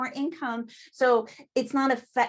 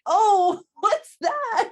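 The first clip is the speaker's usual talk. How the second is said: high, loud, exaggerated oh what's that; hammed-up startled cry; mock surprise; 8 semitones higher, 8 dB louder, much slower than usual. Reacting to Tupperware voice.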